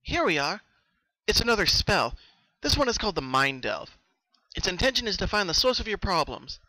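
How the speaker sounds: confident. Acted. Twilight presenting the spell to Pinky, confident. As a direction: announce the spell with assurance. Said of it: "Twilight said confidently".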